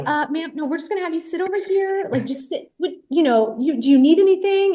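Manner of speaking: high, hesitant, placating voice; polite crew member, imitated. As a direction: tiptoe politely round a confused guest. Imitating crew politely stopping her.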